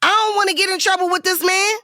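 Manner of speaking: high-pitched, reenacted arguing; defiant comeback. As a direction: argue back without backing down. Retold defiant argument on set.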